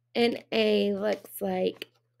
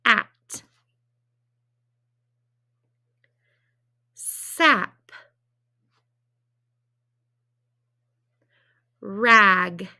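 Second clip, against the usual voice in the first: each word said slowly and clearly, the last two much louder; teacher saying words to echo; 4 semitones lower, 9 dB louder, much slower than usual. Shown as a demonstration. Teacher gives the class words to echo, punching each one loud. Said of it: "My first word is".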